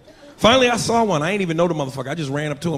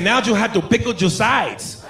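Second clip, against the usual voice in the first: mock Mexican accent; 4 semitones higher, slower than usual. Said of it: "He said".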